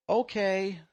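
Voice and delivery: a mock child voice; childlike voice. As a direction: imitate the little imp voices. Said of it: "childlike voices go".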